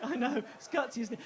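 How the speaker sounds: ordinary talk, nothing marked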